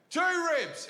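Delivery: high, pleased mock reply; dim earnest answer. Kansas guy proudly topping his own answer, high mock voice. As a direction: proudly offer a dumb answer. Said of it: "he thought about it and he went".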